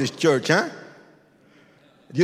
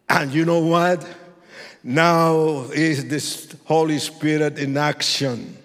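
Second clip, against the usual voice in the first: higher, louder preaching over music; preaching at full force; 6 semitones higher, 7 dB louder than usual. Acted. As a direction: hammer each line of the testimony.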